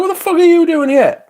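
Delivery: high, loud yell at a pigeon; shocked yell. Retold or imitated. Yelling at a pigeon in the cafe, high and loud. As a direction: shout it up high in disbelief.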